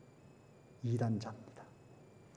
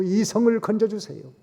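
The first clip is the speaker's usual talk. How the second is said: 불쌍히 여겨주세요 cried out much higher and louder; loud plea; 8 semitones higher, 6 dB louder than usual. Acted. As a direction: beg 여겨주세요 at full voice. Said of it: "그들의 죄를 회개합니다".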